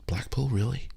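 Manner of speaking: whispered, disbelieving Blackpool, really; mock disbelief under the breath; hushed sceptical question; incredulous whisper. Parent's incredulous reply.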